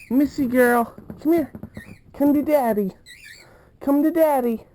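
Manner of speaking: high coaxing pet voice; sing-song call to a pet bird. Real, not acted.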